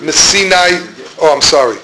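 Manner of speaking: ordinary talk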